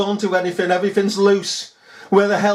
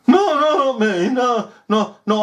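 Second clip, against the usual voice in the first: high, hammed-up protest; husband's hasty denial; 7 semitones higher than usual. Husband denies smoking to his wife, high mock protest. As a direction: deny it in a raised pitch.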